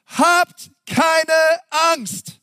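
high, loud, drawn-out "habt keine Angst"; Moses reassuring. Acted. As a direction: Moses calling out to calm the people. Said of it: "Doch Mose sagte zum Volk".

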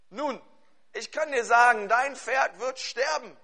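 pressing the charge on the listener; emphatic preaching. Acted. Preaches an accusing example at force.

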